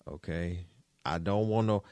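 ordinary delivery

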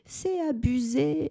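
put-on high, slow whiny child protest; mock child's wail. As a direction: imitate a kid wailing, lightly. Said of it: "en hurlant". (imitation d'un enfant qui pleurniche)

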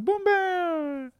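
very high, loud, squeaky call to comrades; rally the troops in a tiny high voice; high squeaky union leader shouting; squeaky rallying cry. Retold or imitated. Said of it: "d'un coup".

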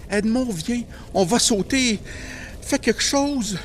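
high, loud pleading; urgent plea. Acted. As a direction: beg him to move, quickly. Urging terrified brother to jump. (supplication urgente, aiguë et forte)

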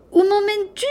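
put-on high, loud alarmed cry from the boy; cried out. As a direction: call out in alarm while flying off. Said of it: "cria le jeune garçon".

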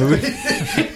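high burst of real laughter. Real, not acted. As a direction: break into a squeaky laugh. Laughs at a funny scene.

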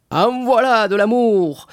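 put-on high, slow, slurring drunkard; drunken voice. A narrator's reading. Caderousse butting in on the lovers' talk, drunken. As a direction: bellow it like a merry drunk. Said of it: "d'une voix de plus en plus avinée".

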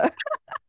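quick high giggle; high laugh. Real, not acted. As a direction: squeal of laughter. Laughs at admitting no preparation.

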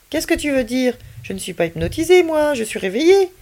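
put-on young boy voice. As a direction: voice the doubtful boy. Child figure's voice.

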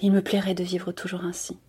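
softly murmured wish. Acted. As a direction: murmur it dreamily to yourself. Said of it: "J'entends Donia Sol murmurer".

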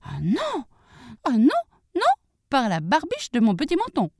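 high-pitched piglet refusal; defiant little pig. Acted. Little pig refuses to let the wolf in, high and defiant.

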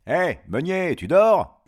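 high, loud wake-up call. Acted. Wolf rousing the sleeping miller, loud call. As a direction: holler to rouse someone dozing.

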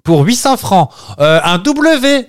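high, drawn-out mock contestant call; game show contestant. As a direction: ham up a player asking for a letter. Imitates a Wheel of Fortune contestant.

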